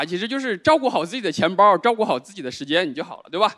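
usual speaking voice

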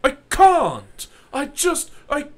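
high, loud, overwhelmed with laughter; can't even. Real, not acted. Recalling Keanu's English accent, high exasperated laughter. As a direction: lose it completely.

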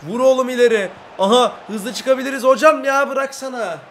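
loud, high urgent calls; excited yelling. Real, not acted. Streamer urging his player to clear the ball, loud and high. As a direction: shout the clearance up the field.

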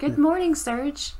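a high, sing-song sister; mock sister voice. Player voicing the sister waking Serge, high and sweet. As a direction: chirp it like an annoying sister.

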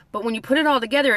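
normal talking voice

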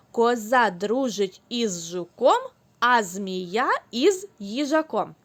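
slow, clear, every sound of Коза дружить із жуком shaped; slow tongue twister. Shown as a demonstration. Teacher leads children through the tongue twister, slowly. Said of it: "повторимо з вами скоромовки".

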